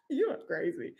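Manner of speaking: ordinary delivery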